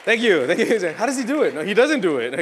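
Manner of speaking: loud, half-shouted mock awe; mock awed crowd. Performer to the crowd, mocking their awe, loud hammed-up awe. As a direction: ham up the crowd's wonder. Acts out amazed crowd and bow.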